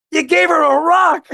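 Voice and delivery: comic disbelieving shout; mock incredulity. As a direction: exclaim it like an appalled spouse, for laughs. Wife's teasing disbelief, retold for laughs.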